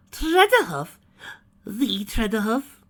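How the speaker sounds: high, loud gasp, slow on the second; starstruck. A narrator's reading. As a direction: swoon over the famous name. Rarity starstruck by celebrity.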